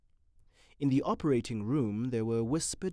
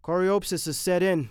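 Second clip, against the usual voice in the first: nervous Renshaw; 6 semitones higher, 8 dB louder than usual. A narrator's reading. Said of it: "said Renshaw nervously".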